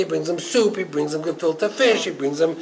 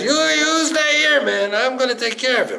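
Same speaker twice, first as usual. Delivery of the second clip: high, emphatic order; forceful command; 8 semitones higher than usual. Retold or imitated. Wife ordering her husband to stay put, fiercely determined. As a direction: take charge in a raised, driving voice. Wife takes charge against angel.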